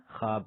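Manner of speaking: "خواب" said as khab, waw dropped; read with silent waw. Shown as a demonstration. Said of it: "پڑھتے کیا ہیں".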